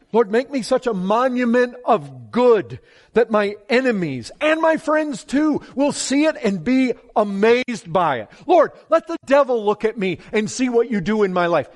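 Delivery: a preacher crying out to God for the church; full-force preaching. Acted. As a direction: call it out to the rafters.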